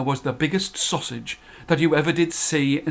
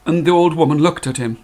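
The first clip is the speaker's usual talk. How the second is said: plain reading voice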